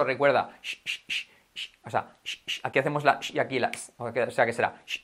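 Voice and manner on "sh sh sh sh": repeated voiceless hushing "sh"; isolated sh. Shown as a demonstration. Teacher drills the sound, isolated sh. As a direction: push out short bursts of "sh". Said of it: "¿Cómo trabajamos eso?".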